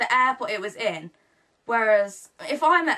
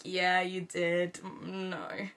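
slow, much softer mock-dry yeah you did; deadpan tease; 3 semitones lower, 7 dB quieter, much slower than usual. Teasing the star about her brother, slow deadpan. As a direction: drag it out flatly to tease him.

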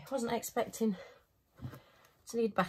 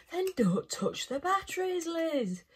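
higher, playful self-telling-off; mock scolding; 7 semitones higher, slower than usual. Viewers mock-scolding Liz for touching the batteries. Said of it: "I can hear you all shouting at me".

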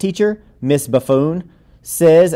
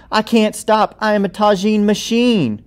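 giddy delight; 8 semitones higher than usual. Acted. Can't stop eating tagine.

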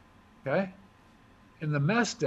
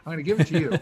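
laughing; 7 dB louder, much faster than usual. Real, not acted. Jokingly handing weapon to friend.

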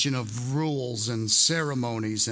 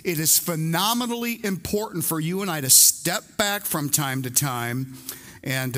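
usual speaking voice

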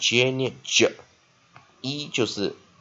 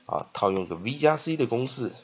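usual speaking voice

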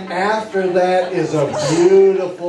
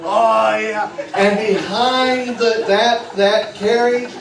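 excited parade story; 5 semitones higher than usual. Acted. Hypnotist walking the six-year-olds through the zoo parade, bright and eager. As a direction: paint the parade like a thrilled children's entertainer.

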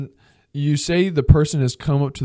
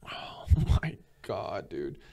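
slow, breath-only disbelief; exasperated whisper; 6 semitones lower, 8 dB quieter, slower than usual. Real, not acted. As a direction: whisper it slowly, dropping low at the end. Exasperated at the inconsiderate person.

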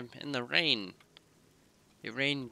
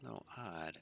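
whispering; 2 semitones lower, 11 dB quieter than usual. Real, not acted. Sneaking, whispering.

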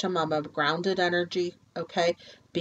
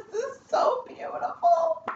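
much higher, slow, voice breaking into sobs; moved to tears by the cards; real crying. Real, not acted. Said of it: "I'm gonna cry".

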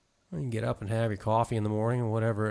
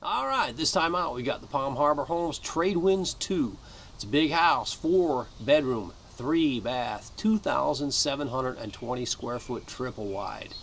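pitchman price rundown; 3 semitones higher than usual. Acted. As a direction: sell it like a host announcing prices.

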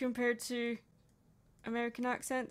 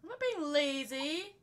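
high, hammed-up protest; put-on indignant voice; 9 semitones higher, 5 dB louder than usual. Streamer retorting to friend's lazy jibe, high mock indignation.

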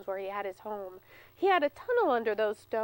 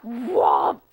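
much louder slam sound; loud whomp; 10 dB louder than usual. Acted. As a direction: smack the word down hard. Bear's paw slams down.

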